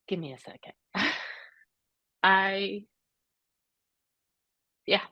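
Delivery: very soft, choked pause for composure; barely audible, fighting tears; holding back tears. Real, not acted. To her host while reliving the dentist, near tears. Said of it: "I get emotional just thinking about it".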